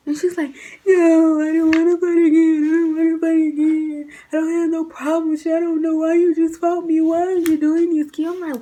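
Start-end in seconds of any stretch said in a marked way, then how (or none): 0.9-8.3 high, loud mock sobbing plea; imitating a crying roommate begging not to fight. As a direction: wail it like a tearful roommate. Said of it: "Why are you crying?".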